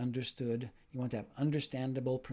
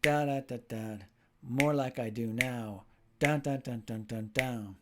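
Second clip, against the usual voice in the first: slow, heavily beat-marked "More like I do now"; teacher hamming up the rhythm with dun dun beats; exaggerated rhythm; 3 dB louder, slower than usual. Shown as a demonstration. Teacher to learners, exaggerating rhythm for them to copy. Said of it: "A little bit extra of rhythm in there".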